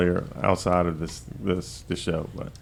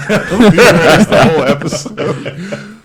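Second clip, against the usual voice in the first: laughing; 9 semitones higher, 8 dB louder, much slower than usual. Real, not acted. Laughing at his own mask.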